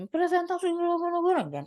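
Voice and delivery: high-pitched overexcited gabble; overly fired-up. Shown as a demonstration. Teacher showing how not to present, overexcited. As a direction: overdo the zeal in a high, gushing voice. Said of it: "jangan terlalu menggebu-gebu gitu".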